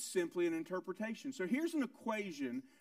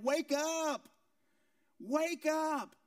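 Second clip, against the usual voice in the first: higher, urgent cry to wake him; urgent wake-up call; 7 semitones higher, much slower than usual. Acted. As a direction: rouse a sleeping man in panic. Disciples rousing sleeping Jesus.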